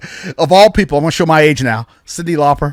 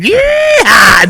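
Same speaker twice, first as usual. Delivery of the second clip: hollering "Yeah!" way up high and loud; hammed-up whoop of a car jumper; mock rebel yell; 5 dB louder than usual. Father mocking Southern stunt drivers with a whoop.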